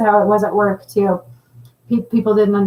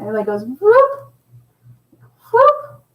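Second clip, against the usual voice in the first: 1 orb whoop; 9 semitones higher, slower than usual. Shown as a demonstration. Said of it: "I keep seeing it up here".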